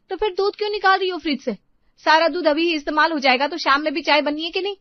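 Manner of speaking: loud, sharp-tongued scolding of a sister-in-law; angry scolding. Acted.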